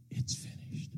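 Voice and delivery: whispered last words, softly. Acted. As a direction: let it barely escape.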